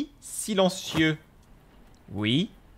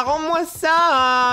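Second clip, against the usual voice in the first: loud, high and very slow; drawn-out yell; 7 semitones higher, 4 dB louder, much slower than usual. Real, not acted. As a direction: stretch out the plea. Dernier appel étiré. (crier en traînant)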